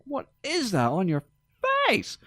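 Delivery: high-pitched appalled disbelief, punched on "face"; mock astonishment. Co-host jokes that astonishing goes either way, mock astonished. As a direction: recoil in comic astonishment. Acting out stunned reaction to someone's face.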